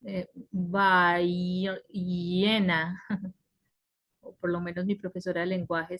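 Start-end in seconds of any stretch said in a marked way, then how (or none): 0.5-3.3 "ballena" slowly split, ll held as a glide; doble L. Shown as a demonstration. Teacher recalling how doble L was taught, slow exaggerated ll. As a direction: draw out the ll in "ballena". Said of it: "hablar con la doble L".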